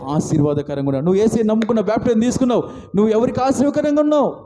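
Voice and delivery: high-pitched, insistent sermon delivery; impassioned preaching. Real, not acted. Preacher challenging the congregation about whom they weep for, impassioned.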